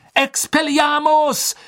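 a high, drawn-out shouted incantation; shouted spell. A narrator's reading. Harry hurling the disarming spell at Pettigrew, shouting. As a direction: fling the spell out at full voice. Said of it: "schrie Harry".

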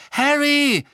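high, loud, anxious cry; frightened call. A narrator's reading. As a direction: call out to a friend in alarm. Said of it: "sagte Hermine sofort und wirkte sehr verängstigt".

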